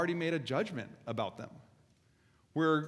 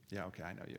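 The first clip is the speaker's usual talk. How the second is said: low, muttered knowingness; dismissive judging; 8 semitones lower, 12 dB quieter, much faster than usual. Acted. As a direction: write them off quietly, pitch dropped. Acting out writing someone off.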